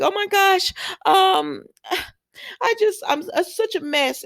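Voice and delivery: high, mock-sobbing fake tears; hammed-up crying. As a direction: overplay the weepy damsel, high and teary. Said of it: "she's all in tears and she's theatrics".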